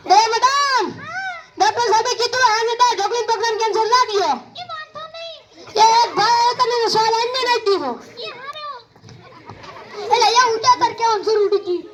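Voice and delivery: ordinary talk, nothing marked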